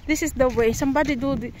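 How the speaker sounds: usual speaking voice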